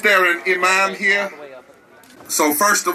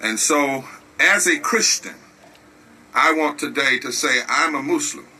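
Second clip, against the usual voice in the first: lower, hushed preacher voice; solemn preaching; 5 semitones lower than usual. Real, not acted. Preacher leads moment of prayer.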